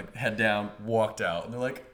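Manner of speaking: ordinary talk, nothing marked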